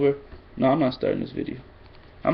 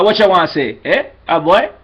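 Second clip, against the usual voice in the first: loud, higher mock Jamaican accent; 5 semitones higher, 6 dB louder than usual. Shown as a demonstration. Tutor demonstrating accent imitation, playful loud Jamaican accent. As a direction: throw out punchy imitation Jamaican phrases. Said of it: "some people copy a Jamaican accent".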